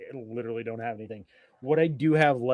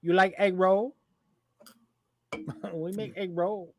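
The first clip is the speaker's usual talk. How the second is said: higher-pitched mock Chinese restaurant voice; lightly put-on Chinese accent, offering food; 6 semitones higher than usual. Co-host playing Chinese restaurant worker offering egg roll, mock Chinese accent.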